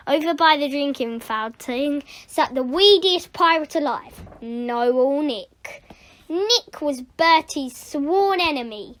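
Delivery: ordinary delivery, nothing marked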